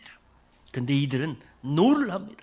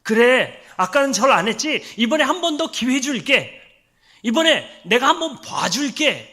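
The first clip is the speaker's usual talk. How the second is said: louder, coaxing king voice; 5 semitones higher, 9 dB louder than usual. Retold or imitated. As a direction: coax the boys into bowing. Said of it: "이 느부갓네살이 한 번은 회유를 해요". (회유하는 왕의 목소리)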